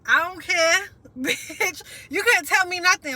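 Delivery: loud, high, brash defiance with a laugh; a retold cocky past self, shouted up high and laughing; defiant don't-care attitude. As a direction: toss it off like nothing can touch you. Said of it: "going through this phase of".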